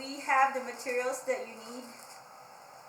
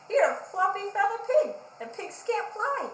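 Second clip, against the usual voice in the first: higher, louder cheeky taunt; mocking Monkey; 8 semitones higher, 7 dB louder than usual. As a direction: jeer at the flapping pig. Monkey mocks Pig.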